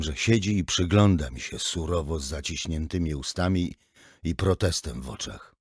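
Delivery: usual speaking voice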